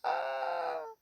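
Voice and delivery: very high mock wail; hammed-up falsetto boo-hoo; fake sobbing. Slapped woman left in tears, high fake sobbing. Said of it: "she starts crying".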